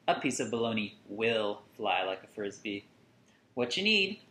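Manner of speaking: ordinary delivery, nothing marked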